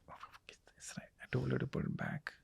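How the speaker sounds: fully whispered private grumble, very soft; inner whisper. Retold or imitated. Husband stewing silently over the laptop, whispered. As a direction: hiss it to yourself so she can't hear.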